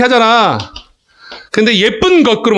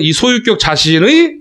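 자신의 drawn out, rising on the final 의; teacher's demo, 자신의 left hanging upward; linked reading of 자신의; 3 semitones higher than usual. Shown as a demonstration. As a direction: hang on the end of 자신의, leaving it open for a noun. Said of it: "이렇게 읽어야 돼".

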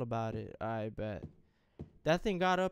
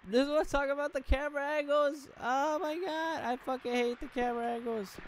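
squealing, pitched-up complaint; high-pitched rant; 11 semitones higher, 4 dB louder than usual. Real, not acted. Gamer ranting at the camera angles, squealing.

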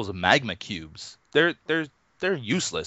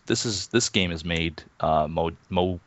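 usual speaking voice

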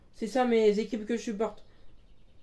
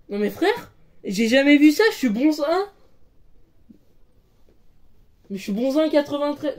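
loud outraged protest; louder, indignant disbelief; 5 semitones higher, 7 dB louder than usual. Real, not acted. Outraged at rank jab.

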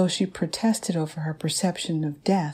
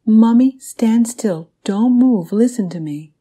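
put-on loud, higher child's command, easing on the last phrase; urgent little girl giving orders; 4 semitones higher, 9 dB louder than usual. A narrator's reading. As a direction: order mommy to freeze, voice raised. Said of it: "Bakara Oni commanded".

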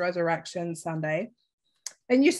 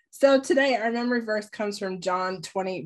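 ordinary delivery

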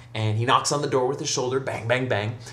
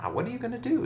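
high-pitched, softer bewildered question; baffled priest; 12 semitones higher, 5 dB quieter, faster than usual. Acted. Priest watching the hunchback prepare to ring the bell, baffled. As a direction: ask it high and helpless.

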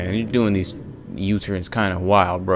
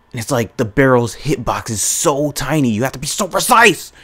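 exasperated rant rising to a loud, high pitch; real frustration; 5 semitones higher, 4 dB louder than usual. Real, not acted. Gamer complains about the barrel's tiny hitbox, exasperated. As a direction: get louder and higher as the exasperation builds. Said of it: "That made me so angry".

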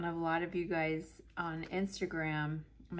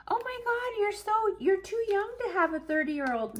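high, squealing mock gush; gushing stranger; 5 dB louder than usual. Imagined stranger flattering her youth, high mock gush. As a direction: overdo the flattery, pitched way up.

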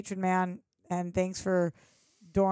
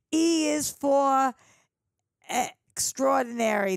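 put-on Boston accent; 2 semitones higher than usual. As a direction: spell it out like a proud Bostonian. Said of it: "Boston shout out".

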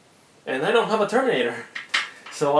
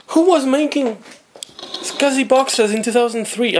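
incredulous; 7 semitones higher, 4 dB louder than usual. Real, not acted. Baffled by the late manufacture date.

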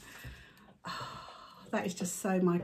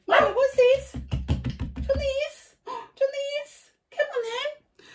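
high dog-talk voice; 4 dB louder than usual. Real, not acted. Owner coaxing her dog Milo with a toy, high baby talk. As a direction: coax the puppy in a much higher voice.

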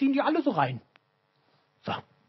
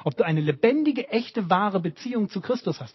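ordinary talk, nothing marked

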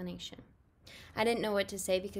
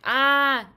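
loud, drawn-out mock scream; acted scream; 6 semitones higher, 15 dB louder than usual. Jamie shows Mom how he reacted, mock scream. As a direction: cry out like a startled kid. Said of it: "I was like, 'Ah!'".

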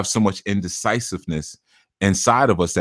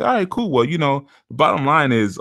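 higher, breezy fake-agreeable voice; mock casual; 7 semitones higher than usual. Shown as a demonstration. Reader showing listeners his fake easy agreement. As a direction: play it easy-going and cool. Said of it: "I say".

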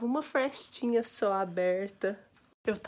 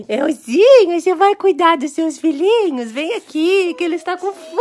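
higher, loud cooing pet voice; 7 semitones higher, 13 dB louder than usual. Real, not acted. Owner cooing to the new mother cat, loud baby talk. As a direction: coo adoringly at the cat.

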